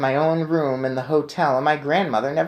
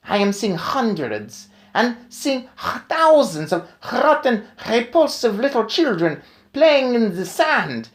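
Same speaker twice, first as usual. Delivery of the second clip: furious witch scream; 9 semitones higher, 3 dB louder than usual. Acted. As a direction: rage at the children on the beach. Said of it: "she screamed".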